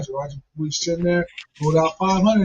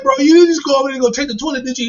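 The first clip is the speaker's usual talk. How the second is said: shocked disbelief; 8 semitones higher, 5 dB louder, faster than usual. Retold or imitated. Shocked quote to uncle.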